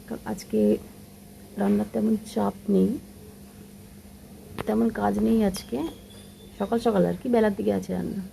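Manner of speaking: hushed voice, keeping it down; speaking softly. Real, not acted. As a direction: talk quietly so nobody hears. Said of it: "একটু আস্তে আস্তে কথা বলছি".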